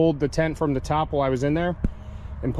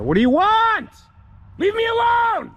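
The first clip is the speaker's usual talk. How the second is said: shouting; 5 dB louder than usual. Real, not acted. Camper yelling at intruders.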